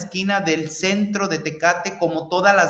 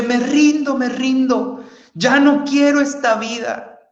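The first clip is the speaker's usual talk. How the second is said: higher pleading cry of surrender; crying out to God; 5 semitones higher than usual. Retold or imitated. Begging God in surrender, pleading higher. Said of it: "empecé a gritarle a Dios".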